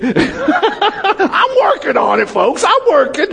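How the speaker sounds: high, slow, laughing through the words; real laughter at his own expense; laughing confession to the congregation. Real, not acted. Laughs after self-joke.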